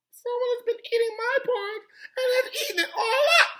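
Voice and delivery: tiny high squeal breaking into a mock wail; hammed-up crying baby bear; wee little Baby Bear voice. A narrator's reading. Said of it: "in his wee little voice".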